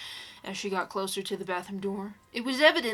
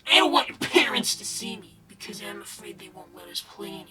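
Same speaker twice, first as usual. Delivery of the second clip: whispering; 4 semitones higher, 5 dB louder than usual. Acted. Quoting creepypasta child voice whisper.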